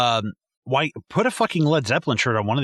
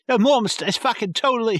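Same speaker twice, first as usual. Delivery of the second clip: put-on a playful, higher British accent as a teen talking to Mom; 8 semitones higher than usual. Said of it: "did you just do a British accent for me?".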